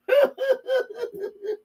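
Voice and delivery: very high cackling laugh; put-on mocking cackle. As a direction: shriek with scorn at them. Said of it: "They're probably laughing at us right now".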